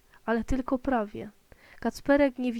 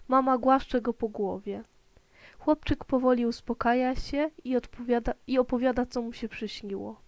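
ordinary delivery, nothing marked

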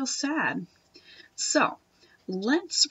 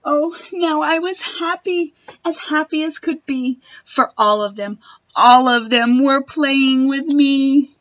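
put-on girl overjoyed that the animals play with her, rising to loud and slow; joyful; 2 semitones higher, 8 dB louder than usual. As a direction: burst out with happiness. Happy ending of the story.

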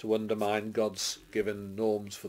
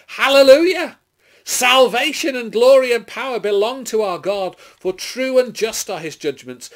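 high, loud heavenly acclamation; triumphant shout; 12 semitones higher, 12 dB louder than usual. A narrator's reading. Great multitude in heaven shouting praise to God, triumphant shout. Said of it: "roar of a great multitude in heaven shouting".

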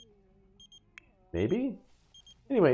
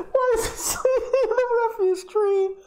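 much higher, squeaky with laughter; laughing disbelief. Real, not acted. Player laughing at Jace sleeping in a tree, squeaky. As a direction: giggle through the words in disbelief.

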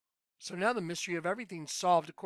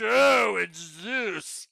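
mocking the lunatics; 4 dB louder, much slower than usual. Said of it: "When I see these lunatics".

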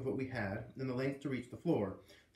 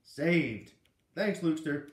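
relieved cheer; 4 semitones higher, 7 dB louder, slower than usual. A narrator's reading.

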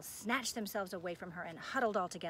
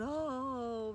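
long high moaned "oh"; moaning; 5 semitones higher, 4 dB louder than usual. A narrator's reading. Gargoyles nestling close to Dakin, moaning. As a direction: draw out a plaintive moan.

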